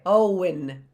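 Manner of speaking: "Owen" in two syllables, oh-win; wrong pronunciation. Shown as a demonstration. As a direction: sound the W in "Owen". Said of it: "It's not Owen".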